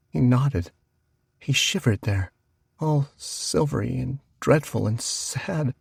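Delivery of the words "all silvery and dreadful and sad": ordinary delivery, nothing marked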